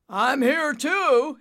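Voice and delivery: slow, loud booming call. Acted. Father answering Nimbus, slow booming shout. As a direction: boom it back across the sky. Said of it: "he boomed back".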